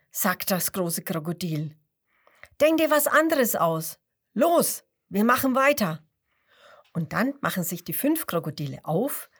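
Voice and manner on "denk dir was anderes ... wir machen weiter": big crocodile ordering. A narrator's reading. Big crocodile bossing little one.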